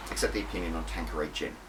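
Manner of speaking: ordinary delivery, nothing marked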